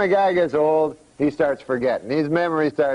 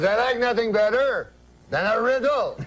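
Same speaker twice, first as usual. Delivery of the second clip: put-on higher-pitched comic French-Canadian accent; Jean-Luc's French-Canadian accent; 6 semitones higher than usual. Uncle answers the cab driver, higher French-Canadian accent. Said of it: "That's how he talks".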